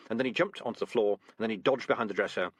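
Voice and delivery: ordinary delivery, nothing marked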